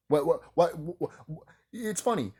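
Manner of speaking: usual speaking voice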